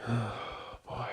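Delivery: very soft sighed-out word; weary sigh. Real, not acted. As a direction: exhale the word, drained. Stressed after venting about debt.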